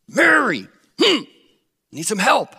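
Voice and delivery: high, loud call from the kitchen; Martha calling out. Retold or imitated. Preacher voices Martha calling Mary from the kitchen, high and loud.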